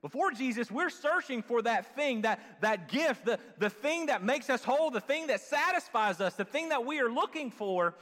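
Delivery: high, loud, building list; fired-up preaching. Real, not acted. Preacher on searching before Jesus, fired-up preaching. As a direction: press each phrase out loudly, like a preacher.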